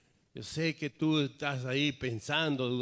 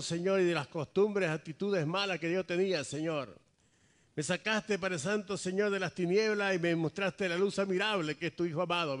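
pleading prayer building from raised voice to high, loud cry; fervent prayer; 10 semitones higher, 9 dB louder than usual. Real, not acted. Pastor leading the congregation in repentance before God, fervent prayer. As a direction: implore God with mounting urgency. Leads prayer with rising intensity.

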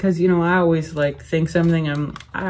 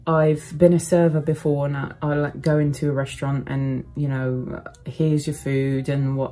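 amateur British accent demo. Shown as a demonstration. Vlogger showing viewers the accent she used as a server, British accent. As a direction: talk in a put-on British accent with a slight Irish lilt. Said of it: "I have done my British accent".

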